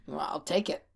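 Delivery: low, soft mutter. Retold or imitated. Retelling a refused woman leaving, muttering. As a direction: grumble it low under your breath. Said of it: "She left muttering".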